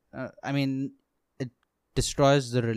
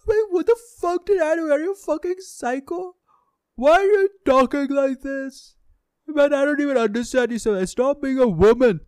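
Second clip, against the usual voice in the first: much higher, louder imitated yell; confused friend yelling, higher and louder; friend's angry outburst; 12 semitones higher, 6 dB louder than usual. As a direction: shout back at him, incredulous and furious. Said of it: "He's like".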